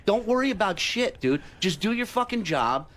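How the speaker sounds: normal talking voice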